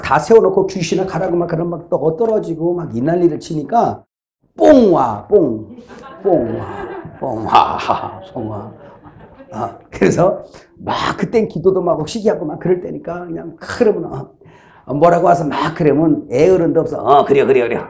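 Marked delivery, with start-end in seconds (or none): 4.6-8.7 a fading, half-laughing chant of nonsense syllables; playful imitation of revival chanting; mock revival chant. Imitating frenzied revival noises. 17.0-17.8 chuckling through a mocking yes yes yes; mock agreement through a laugh. Mocking his younger self's style.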